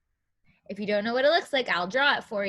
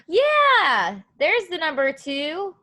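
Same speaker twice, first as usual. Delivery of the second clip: bright, higher yeah of discovery; excited find; 5 semitones higher, 6 dB louder, slower than usual. Real, not acted. Teacher finding the number in the picture, excited. Delighted discovery after gasp.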